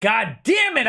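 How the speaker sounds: ordinary talk, nothing marked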